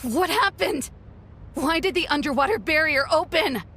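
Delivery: high, breathless alarm. Acted. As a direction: demand answers in alarm. Alarmed at the open barrier.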